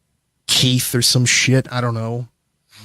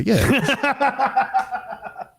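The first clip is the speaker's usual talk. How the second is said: laughing; 10 semitones higher, 5 dB quieter than usual. Real, not acted. Laughs at his own nickname.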